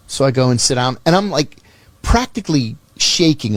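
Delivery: ordinary delivery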